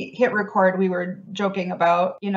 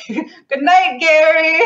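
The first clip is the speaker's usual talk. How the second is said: high, loud mid-Atlantic singsong imitation; mid-Atlantic accent; 9 semitones higher, 8 dB louder, much slower than usual. Sister bids her ghost goodnight, hammed-up mid-Atlantic accent. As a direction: call out grandly in mock mid-Atlantic. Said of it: "mid-Atlantic, mid-century accent thing".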